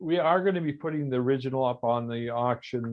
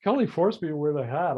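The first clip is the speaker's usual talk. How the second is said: ordinary talk, nothing marked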